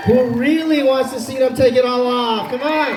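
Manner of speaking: high, loud rally call; crowd hype shout. Real, not acted. Hyping crowd louder.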